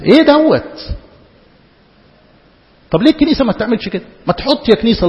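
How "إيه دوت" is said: higher, louder incredulous repeat; exasperated outburst. Real, not acted. Preacher repeating his question to the congregation, now exasperated. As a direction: throw the question out in disbelief.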